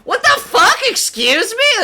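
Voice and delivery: loud, very high shriek of mock shock; outraged disbelief. Player reacting to an absurd item drop, hammed-up disbelief.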